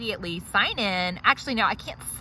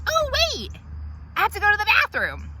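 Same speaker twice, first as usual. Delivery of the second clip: high, fast interruption; patient suddenly needing the bathroom; sudden high whine; 11 semitones higher, faster than usual. Acted. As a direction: blurt it out high. Sudden request in the chair.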